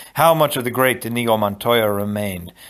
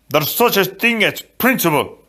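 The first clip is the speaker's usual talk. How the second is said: higher, insistent character voice; a touch of offended principle, pitched up; mildly indignant retort; 7 semitones higher, 3 dB louder than usual. A narrator's reading. Fezzik answering Inigo about the lie, mildly indignant.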